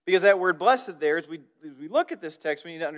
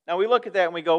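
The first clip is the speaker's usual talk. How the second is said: ordinary talk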